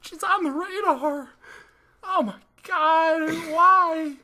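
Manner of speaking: high, loud, slow wailing mock voice; that karaoke voice. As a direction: wail it like terrible karaoke. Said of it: "I sing it in that voice".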